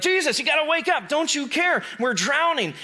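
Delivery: high, pleading disciples in a panic; panicked plea. Acted. Frightened disciples waking Jesus, a high panicked plea. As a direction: beg him to wake up, high and urgent.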